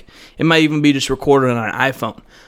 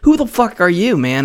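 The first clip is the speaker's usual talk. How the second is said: usual speaking voice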